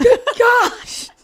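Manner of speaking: high, loud exclamation; exasperated outburst. Real, not acted. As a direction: cry out in mock dismay. Exasperated at more name leaking.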